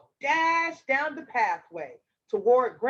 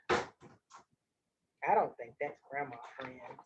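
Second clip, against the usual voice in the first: quiet aside; 11 semitones lower, 9 dB quieter, slower than usual. Real, not acted. Aside to listeners.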